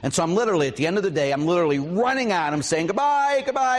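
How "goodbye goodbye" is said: calling goodbye. Retold or imitated. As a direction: sing out your goodbyes as you hurry off. Calling out while running off.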